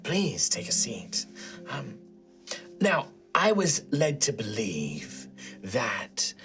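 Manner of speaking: a slow, low, breathy whisper. Acted. The sister questions the companions in secret, a slow whisper. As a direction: speak like a tired old nun keeping secrets.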